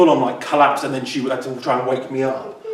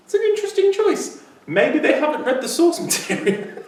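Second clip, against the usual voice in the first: much higher, mock-polite judging voice; diplomatic doubt; 14 semitones higher than usual. Panel member watching an odd audition interpretation, diplomatically doubtful. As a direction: pitch it high and tactful, amusement creeping in. Acts out a puzzled panel's reaction.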